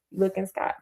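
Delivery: ordinary delivery, nothing marked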